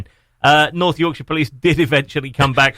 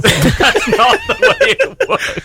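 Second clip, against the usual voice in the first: slow, much higher, through laughter; laughing; much slower than usual. Real, not acted. Co-host laughing while correcting the Hobbit accent claim.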